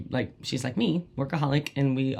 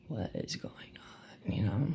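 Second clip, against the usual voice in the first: whisper; 5 semitones lower, 14 dB quieter than usual. Retold or imitated. Confused in the car.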